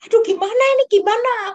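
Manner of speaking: high, hurried fretting; panicky worrying. Retold or imitated. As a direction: friend panicking that the donuts will run out. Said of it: "aku bingung sama Tin".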